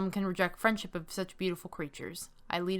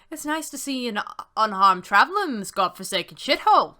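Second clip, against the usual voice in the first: loud, bold bearded soldier; strong, bold; 3 semitones higher, 8 dB louder than usual. A narrator's reading. Bearded man greets a traveler at camp, strong and bold. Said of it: "His voice is strong".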